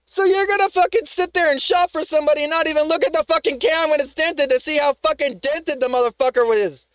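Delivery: real fury at the careless store; angry rant. Real, not acted. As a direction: tear into them, words tumbling out. Ranting at store over dented can.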